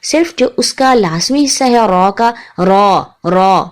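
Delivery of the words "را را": را slow with one tap, full heavy sound; را slowly, one tap, deep open a; heavy tapped ra. Shown as a demonstration. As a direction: drawl را with a single tap, thick vowel. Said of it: "ایک ہٹ اس کو لگتی ہے، آواز موٹی رکھنی ہے".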